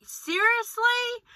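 high, drawn-out mock astonishment; slow, incredulous squeak upward; disbelieving reaction. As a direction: imitate someone who cannot believe it. Retells others' disbelief at her age.

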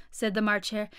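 ordinary delivery

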